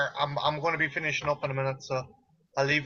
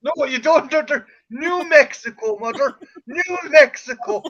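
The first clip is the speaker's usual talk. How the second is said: loud, high, insisting correction; exasperated correction; 10 semitones higher, 7 dB louder than usual. Acted. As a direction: drill the name into her. Correcting mother about New Mexico.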